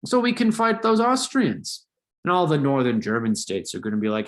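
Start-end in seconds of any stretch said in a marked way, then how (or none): 0.1-1.9 high, hammed-up rallying Bismarck; mock Bismarck. Teacher voicing Bismarck rallying northern states, high mock excitement. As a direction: pump up the crowd in a mock-excited voice. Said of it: "and Bismarck's like".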